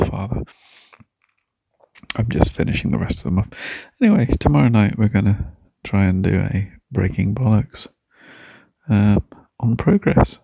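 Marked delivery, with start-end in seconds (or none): none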